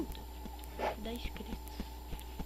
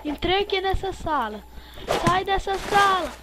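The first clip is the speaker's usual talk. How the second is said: frantic; 7 semitones higher, 9 dB louder than usual. Real, not acted. Fleeing the killer.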